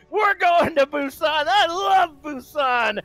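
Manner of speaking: thrilled high shout; excited shout. Real, not acted. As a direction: shout it with joy. Caster hype call.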